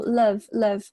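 "love" as the l of middle run into a weak "of"; "love" said as a linked syllable, l plus "of"; linked sounds. Shown as a demonstration. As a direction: link the final l to "of", making "love" sound like "lev". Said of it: "和这个of连读".

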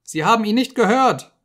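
high, loud frantic shriek; rising to a scream. Acted. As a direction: scream it out in terror. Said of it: "steigerte sich zu einem Schreien".

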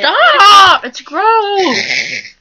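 high, loud disgusted shout; shouting stop. Real, not acted. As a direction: yell at her to stop, grossed out. Friend pestering to pet face.

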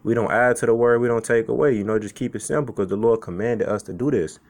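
normal talking voice

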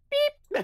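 sharp high squeaky beep. Pretend. Voicing a game character's beep, high squeak. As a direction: chirp it out like a little robot.

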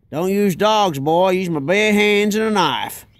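put-on tough-guy Texan accent. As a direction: play the boastful Texan hunter in his Texan accent. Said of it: "back in Texas".

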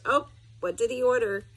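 ordinary delivery

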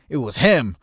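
loud, high blurted accusation; retold accusing shout. Teller replays pointing at the wrong man, accusing shout.

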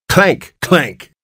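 high, ringing sound word; imitated clank. A narrator's reading. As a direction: mimic the basin clattering. Basin clattering down stairs.

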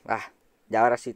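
ordinary delivery

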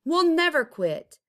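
high, loud defiant vow; defiant shout. Acted. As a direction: vow it with fire. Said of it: "they said".

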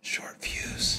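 breathy unvoiced whisper. Real, not acted. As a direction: whisper it as a secret. Whispered tattoo idea.

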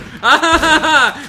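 high, loud burst of laughter. Real, not acted. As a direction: crack up loudly.

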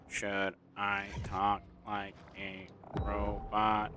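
slow, mechanical robot impression. Shown as a demonstration. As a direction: say it like a machine, word by word.

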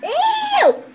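very high, drawn-out shriek; disgusted squeal. Pretend. Reacting to the wet card, disgusted squeal. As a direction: squeal as if grossed out.

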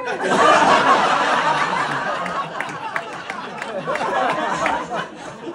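loud, long, high laugh; long high laughter. Real, not acted. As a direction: laugh helplessly at the heckle. Long laugh after heckle.

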